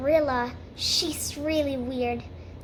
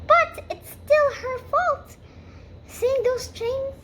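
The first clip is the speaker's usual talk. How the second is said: high-pitched, indignant insistence; defensive protest; 11 semitones higher, 4 dB louder than usual. Acted. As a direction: argue back in an affronted high voice. Rachel insists, arguing back.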